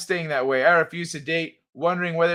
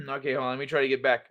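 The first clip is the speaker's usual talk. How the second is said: ordinary talk, nothing marked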